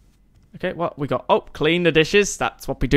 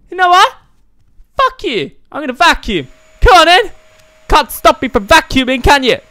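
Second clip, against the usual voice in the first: high-pitched hammed-up tantrum; mock defiant yelling; 11 semitones higher, 5 dB louder than usual. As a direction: squeal it in playful defiance. Mock quarrel with game wife.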